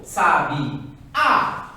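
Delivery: "sabe a" dito como sabiá, força no A final; chamando a palavra. Shown as a demonstration. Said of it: "chamando a palavra". ("sabe a" com a última sílaba tônica, separada)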